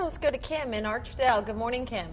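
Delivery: ordinary talk, nothing marked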